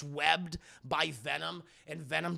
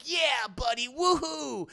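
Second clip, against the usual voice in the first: high whooping cheer, hammed up; cheesy action-hero whoop; mock buddy cheer; 9 semitones higher, 4 dB louder, slower than usual. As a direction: holler like corny teammates. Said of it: "they're tag teaming".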